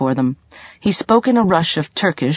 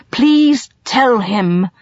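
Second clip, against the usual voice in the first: slow, higher, louder plea; begging; 7 semitones higher, 4 dB louder, much slower than usual. A narrator's reading. Colin begging Sabahat to translate, slow and pleading. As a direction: drag it out as a desperate please. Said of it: "Colin begged".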